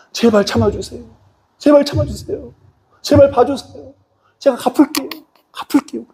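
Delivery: high-pitched mock-tearful begging; tearful pleading. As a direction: plead like a desperate debtor before the king. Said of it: "눈물 흘리면서". (울먹이며 높은 소리로 애원하듯)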